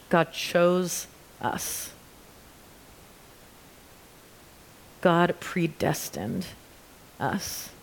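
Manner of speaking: slow, measured reading with long pauses. Real, not acted. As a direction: read it slowly, letting each statement sink in. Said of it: "I'm gonna read it slower".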